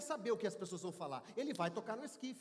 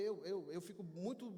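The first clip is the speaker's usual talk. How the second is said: ordinary talk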